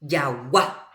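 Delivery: Yagua with the last A lifted; stress on final A. Shown as a demonstration. Teacher showing where the accent falls, stress on final A. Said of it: "última A".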